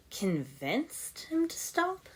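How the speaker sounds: high, slow incredulous echo; disbelieving question. A narrator's reading. Ninja echoing Lloyd's strange claim, slow and incredulous. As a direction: say it like you can't believe it. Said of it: "That got some weird looks".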